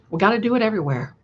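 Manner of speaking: ordinary talk, nothing marked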